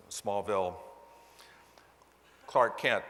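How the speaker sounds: usual speaking voice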